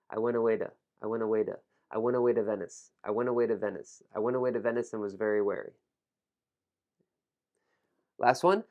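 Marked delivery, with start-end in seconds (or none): 0.1-5.7 "went away to" linked as "wenawayda"; fast, reduced sounds. Shown as a demonstration. Teacher building up a tongue twister, "went away to" fast and reduced. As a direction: run "went away to" together, dropping the t. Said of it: "when I say it fast, notice how I, I reduce sound".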